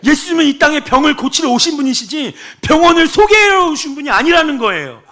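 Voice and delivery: high, loud declaration; fervent sermon cry; preaching shout. Acted. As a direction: proclaim it boldly. Preacher shouts key point.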